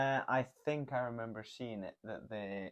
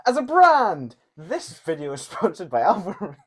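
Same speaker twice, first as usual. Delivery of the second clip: mock ad announcer; 9 semitones higher, 15 dB louder than usual.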